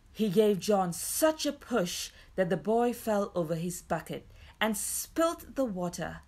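ordinary delivery

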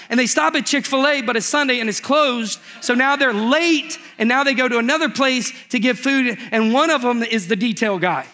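ordinary talk

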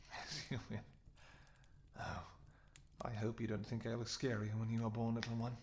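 ordinary talk

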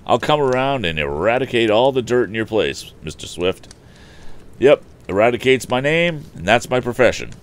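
a hint of a chatty character; Eradicate, cheery odd-job man. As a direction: announce your trade proudly. Voices Eradicate.

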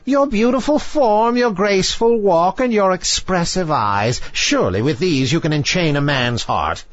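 high, coaxing then commanding witch voice; high-pitched witch, impatient for payment; goading sea witch. A narrator's reading. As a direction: goad her on as a witch.

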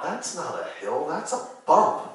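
blurted out. Retold or imitated. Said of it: "blurt out".

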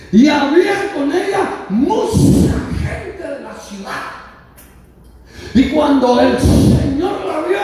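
loud, high sermon cry; full-force preaching. Acted. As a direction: proclaim it to the whole congregation. (predicación a plena voz)

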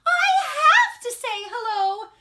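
high, loud sing-song mother; insisting mom. Acted.

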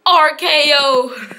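high, loud, drawn-out shout; excited move call. Real, not acted. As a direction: stretch the big move into a shout. Calling the finishing move.

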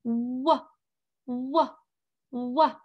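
wah as a clear w sound from puckered lips; letter sound demo. Shown as a demonstration. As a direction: say wah with rounded lips opening wide. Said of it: "Can you make that W sound?".

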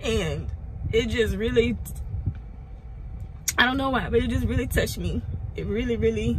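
fighting to keep from crying; holding back tears. Real, not acted. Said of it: "I'm trying not to cry".

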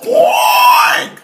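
very high, loud shrieking oink; surprised oink shriek. Acted. Herman shrieks a startled oink as Harold bursts in. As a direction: squeal like a startled pig. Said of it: "shrieked Herman in surprise".

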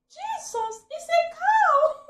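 high, loud, drawn-out yell; shouted. Retold or imitated. Wife retelling her panic at the cow, loud yell. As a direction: scream it in startled alarm. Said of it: "So I shouted".

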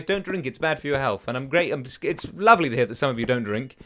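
normal talking voice

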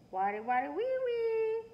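put-on very high, loud baby screech. A narrator's reading. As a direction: screech gleefully like a toddler. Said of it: "Baby Louie screeched".

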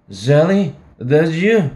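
put-on drunken shout.

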